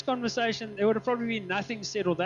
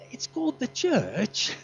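slow, higher put-on British accent; 4 semitones higher, slower than usual. Pastor names his organisation to his seatmate, drawn-out British accent. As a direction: draw it out in a playful British accent. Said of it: "in the beautiful British accent".

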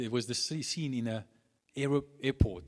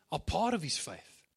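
usual speaking voice

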